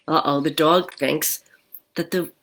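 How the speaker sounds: plain reading voice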